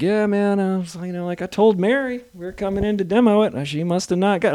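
high, easygoing dude voice; laid-back contractor. Retold or imitated. Contractor voiced to the host, loose and easy. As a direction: play the chill contractor.

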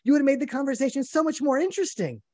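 mock reasonable plea. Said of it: "So I said".

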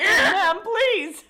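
very high, loud, laughing plea; mock apology. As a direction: gush an apology in a squeaky voice.

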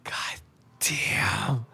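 real awed whisper; stunned whisper. Real, not acted. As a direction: let it slip out under the breath. Stunned by scene.

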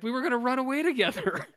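high, loud, fast whiny wail; hammed-up heartbroken cry; betrayed wail. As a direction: wail it like a jilted partner. [name] wails at betrayal.

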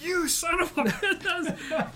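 drawn-out high mock yell, laughing; flustered outburst, imitated. Singer realizes the band is pranking her, mock outraged yell. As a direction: howl it slowly and high through laughter. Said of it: "she was getting all flustered".

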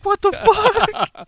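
high, slow disbelieving squeak; baffled what the. Retold or imitated. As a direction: react in total disbelief. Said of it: "I'm like".

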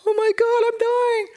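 put-on sudden high loud panicked cry of a dying boy. Sick boy crying out to himself in terror, high and loud. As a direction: shriek it out like you're sure you're dying. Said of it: "and I was like".

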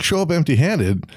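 put-on scoffing repeat, lightly French-accented; incredulous French-ish echo. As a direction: throw it back in a loose French accent. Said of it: "what accent I'm doing right now".

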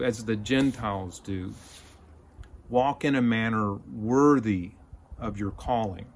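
ordinary talk, nothing marked

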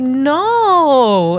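put-on high, drawn-out child's "no"; long, swooping, teasing no; playful toddler refusal. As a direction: imitate a giggly little girl refusing. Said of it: "laughing and running away".

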